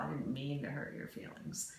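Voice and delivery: soft whispered sorry; a husband's hushed apology. Retold or imitated. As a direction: breathe it out as a gentle apology. Husband's voiced reply.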